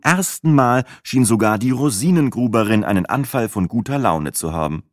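ordinary delivery

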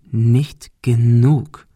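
very slow, deliberate threat; drawn-out bully's warning; menacing repetition. A narrator's reading. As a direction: space the words out menacingly. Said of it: "wiederholte Mike".

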